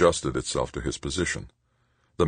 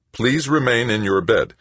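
higher, louder machine voice, even and insistent; automated warning from the bed monitor; synthesized computer voice; 8 semitones higher, 4 dB louder than usual. A narrator's reading. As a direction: announce it like a hospital computer. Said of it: "demanded a computer's synthesized voice".

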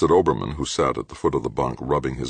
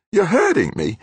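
much higher, louder cry of pain; 5 dB louder than usual. A narrator's reading. Halleck crying out as Grant grips her. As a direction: plead through the pain.

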